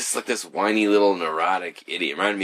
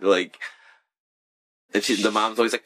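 ordinary talk, nothing marked

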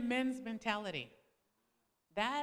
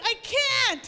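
much higher and louder, digging in; stubborn refusal; 11 semitones higher, 11 dB louder than usual. Retold or imitated. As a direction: refuse him flatly. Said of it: "And I would be like".

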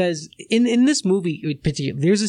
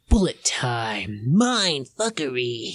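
put-on voice; 3 semitones lower, much slower than usual. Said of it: "I like that voice".

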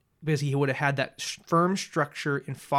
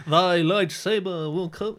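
hammy stage actor; 4 semitones higher, slower than usual. Pretend. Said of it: "I can bring my old English accent in".

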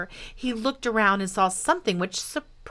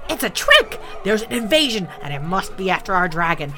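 angry yelling; 4 dB louder than usual. Acted. Raging animals yelling about the invasion, angry alarm. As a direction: raise the alarm like a furious mob. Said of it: "all yelling".

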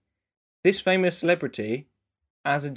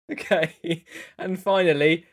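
ordinary delivery

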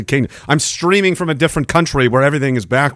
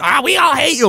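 mocking haters' voice; 13 semitones higher, 4 dB louder than usual. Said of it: "it's like".

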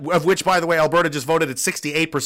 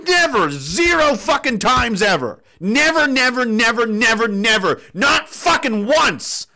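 rising anger, louder and higher, hammering each never; angry repeated nevers; 4 semitones higher, 4 dB louder, slower than usual. Real, not acted. Furious ranting viewer address, loud and higher. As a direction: vent at the people running the country, never after never.

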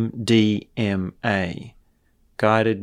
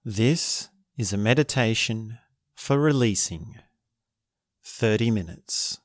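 guided meditation; 5 dB quieter than usual. Acted. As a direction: guide them gently into stillness.

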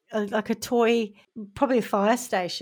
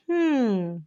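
usual speaking voice

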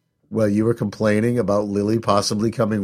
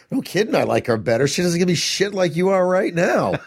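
sarcastic, climbing much higher toward a laugh; sarcastic inner retort; 8 semitones higher than usual. Retold or imitated. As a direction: unspoken sarcastic reply to his daughter, rising and laughing. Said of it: "I'm like".

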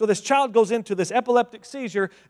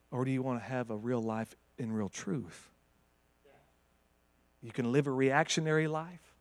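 lower, softer, calm truth-telling; gentle Jesus voice; 7 semitones lower, 7 dB quieter than usual. Acted. Jesus speaking calmly to the father, soft and low.

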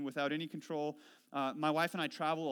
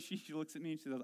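ordinary talk, nothing marked

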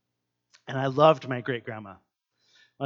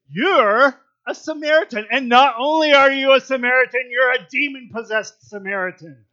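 higher, louder jeering accusation; mock insult; 9 semitones higher, 9 dB louder than usual. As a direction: throw the insult like a quarrel. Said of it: "they're kind of fighting".

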